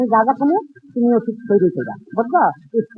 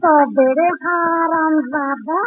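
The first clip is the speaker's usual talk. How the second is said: drawn-out threat; 6 semitones higher, much slower than usual. Acted. Priest attacks pupil.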